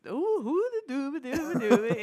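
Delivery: high, loud mock Swedish gibberish; Swedish Chef voice. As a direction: babble like the Muppet chef in Swedish accent. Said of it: "that's Swedish chef".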